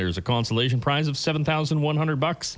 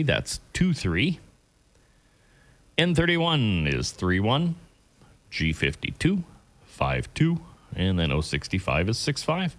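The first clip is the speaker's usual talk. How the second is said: bingo caller; slower than usual. Acted. Calls the lucky numbers.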